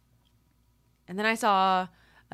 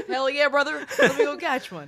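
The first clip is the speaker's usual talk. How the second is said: much higher, louder hillbilly whoop; 8 semitones higher, 8 dB louder than usual. Pretend. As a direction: whoop it like a gung-ho country boy. Playful redneck voice.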